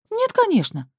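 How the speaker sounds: indignant, Нет flung high. A narrator's reading. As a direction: bristle at the question. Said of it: "возмутилась Маргарета".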